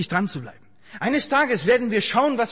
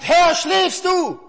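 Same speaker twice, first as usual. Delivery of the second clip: higher, louder, slow anguished call; David cries out to God, asking if he sleeps, in a high, slow, loud plea; David crying out; 8 semitones higher, 6 dB louder, much slower than usual. Acted. Stretch each word in a desperate cry upward. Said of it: "da ruft David".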